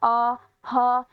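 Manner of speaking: very slow, drawn-out "आ, हा"; vowel sound demo. Shown as a demonstration. Teacher demonstrating the vowel sound to the class, stretched slowly. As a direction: stretch the "आ" long and open. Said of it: "यानी आ".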